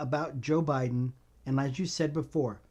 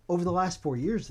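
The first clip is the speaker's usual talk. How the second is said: usual speaking voice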